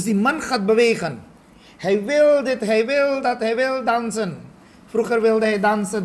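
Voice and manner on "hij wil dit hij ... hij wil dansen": eager mind. Retold or imitated. Acting the restless mind.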